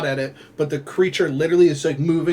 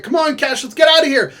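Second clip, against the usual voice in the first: higher, louder frantic yell; mock panicked call to escape; urgent shout; 9 semitones higher, 5 dB louder than usual. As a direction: shout at her to run. Urging companion to flee.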